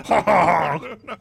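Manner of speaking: loud, slow, high laughing shout. Real, not acted. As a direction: blurt it through a laugh. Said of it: "what my villain laugh would be".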